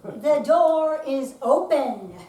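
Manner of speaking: loud, slow call to the door. Acted. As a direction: call it out to someone outside. Calling out to visitor.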